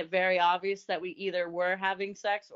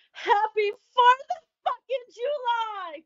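high, drawn-out celebratory yell; loud scream; 14 semitones higher, 6 dB louder, slower than usual. Retold or imitated. Telling how she made it obvious to the roommate, screamed loud. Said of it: "I screamed it really loud".

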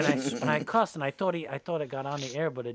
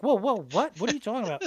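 surprised; 7 semitones higher, 7 dB louder than usual. Retold or imitated. Radio host reacting, confused.

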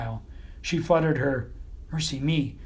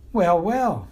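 breathy, gushing lady dog; 4 semitones higher, 5 dB louder than usual. A narrator's reading. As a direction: gush it out sweetly. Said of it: "she gushed".

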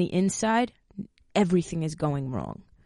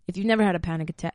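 fast, tumbling first attempt at the line; rushed first try; much faster than usual. Real, not acted. Explaining panic attacks to viewers, in a rushed first try. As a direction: blurt it out quickly, then start over. Restarts the same sentence.

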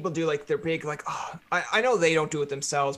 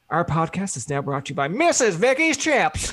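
ad read; 2 semitones higher, 5 dB louder than usual. Retold or imitated. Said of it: "I'd be like".

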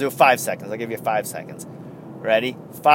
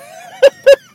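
laughing; 13 dB louder than usual. Real, not acted.

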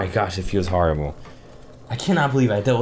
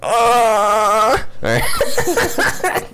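pained cry; 11 semitones higher, much slower than usual. Real, not acted. Groaning from stomach cramp.

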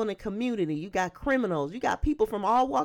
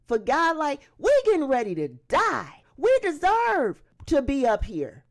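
high, loud, outraged challenge; indignant rebuke; 8 semitones higher, 4 dB louder than usual. Acted. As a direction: rebuke him hard, voice climbing to an appalled peak. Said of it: "The other criminal responded for him".

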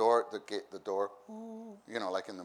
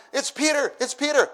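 high, loud, breathless telling; excited announcing; 11 dB louder than usual. Retold or imitated. Rhoda running to tell the praying church, excited. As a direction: run in shouting the news.

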